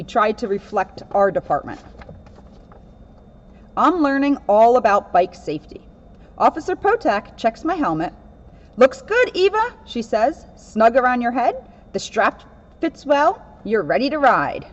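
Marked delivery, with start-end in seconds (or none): 3.8-14.7 lively read-aloud storybook voice; picture book reading, lightly animated; reading to children. Acted. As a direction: read it to a room of kids.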